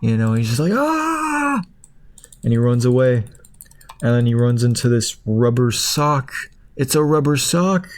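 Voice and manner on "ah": sudden high-pitched "Ah!" scream. Retold or imitated. Hot dog recoiling from the horrors with a scream.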